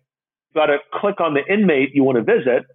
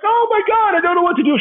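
very high-pitched imitation of overwhelmed panic; distraught. Woman told she'll lose both boyfriends, mock distraught. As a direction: mimic a woman wailing in dismay. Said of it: "She starts crying".

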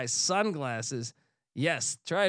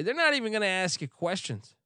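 ordinary delivery

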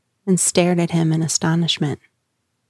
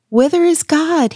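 much higher, louder anguished cry; 9 semitones higher, 4 dB louder than usual. A narrator's reading. Madman crying out to his mockers, high and loud. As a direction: cry the question out to the crowd, pitched high. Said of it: "he cried".

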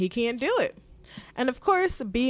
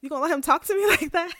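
higher-pitched, fast, indignant "excuse me" with a laugh; offended sass; 6 semitones higher, faster than usual. Retold or imitated. Mysaria snapping back at Otto's insult, indignant and fast. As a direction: play the insulted mistress, half laughing. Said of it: "She's like".

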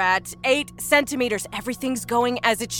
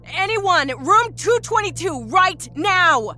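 high, loud desperate call; urgent, drawn-out high yell; 12 semitones higher, 5 dB louder, slower than usual. A narrator's reading. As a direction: yell for help down a long hall. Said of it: "she yelled down".

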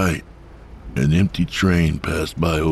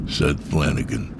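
usual speaking voice

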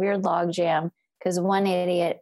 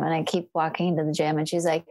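normal talking voice

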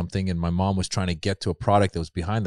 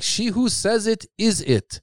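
much higher, slow prim comeback; imitating mom; 12 semitones higher, slower than usual. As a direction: mom trying not to cuss. Imitating mom's mild comeback.

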